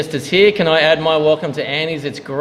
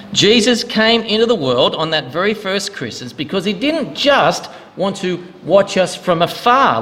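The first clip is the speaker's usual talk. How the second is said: ordinary delivery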